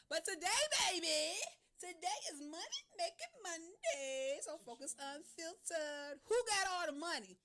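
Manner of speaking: high, loud, laughing hype; excited announcement. Real, not acted. Radio host hyping Money Making Monday, loud and laughing. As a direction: sell the theme day with a grin.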